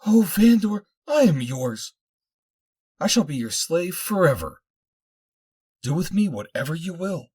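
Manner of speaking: high, loud, overwrought devotion; distraught, hysterical. A narrator's reading. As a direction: give yourself over in a high hysterical outburst. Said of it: "she was distraught and hysterical".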